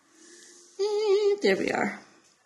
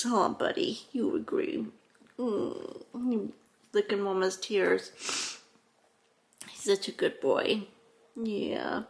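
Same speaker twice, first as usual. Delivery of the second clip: softer, tender voice for the dog who licks her tears; soft pet talk; 7 dB quieter than usual. Real, not acted. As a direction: coo gently at your dog.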